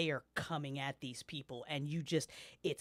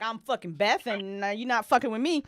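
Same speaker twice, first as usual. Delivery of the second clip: defiant; 6 semitones higher, 8 dB louder, faster than usual. Retold or imitated. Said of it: "you're like".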